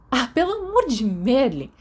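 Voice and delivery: loud, high exasperated outburst. A narrator's reading. Sirius, fed up with James stalling, exclaims loudly. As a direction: throw up your hands, fed up.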